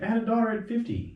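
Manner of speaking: high-pitched quoted disbelief; amazed. Retold or imitated. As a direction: imitate him marvelling at late fatherhood. Quoted comic marvels at late fatherhood.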